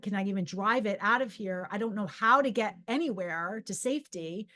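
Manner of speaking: ordinary talk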